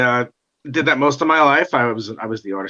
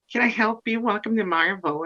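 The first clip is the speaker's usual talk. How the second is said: high, soft receptionist voice; receptionist greeting; 8 semitones higher, 4 dB quieter than usual. Retold or imitated. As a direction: greet visitors in a light, high voice.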